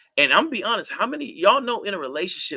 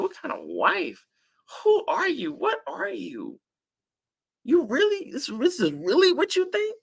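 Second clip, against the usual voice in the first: high, disdainful mock spouse looking down; contemptuous scoff; 9 semitones higher than usual. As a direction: sneer at them like they're beneath you. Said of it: "contempt is that look like this".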